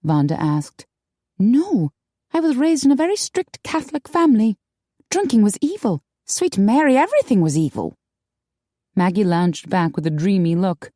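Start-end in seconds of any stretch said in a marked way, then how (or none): 1.4-8.0 put-on higher, brighter girlish voice; high young woman voice. A narrator's reading. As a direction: play the upset young woman. Maggie recalls.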